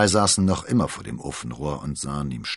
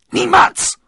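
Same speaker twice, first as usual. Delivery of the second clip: much higher, very loud furious scream; 16 dB louder than usual. A narrator's reading. Milady screaming defiance at Athos. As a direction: shriek the refusal. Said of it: "schrie Milady".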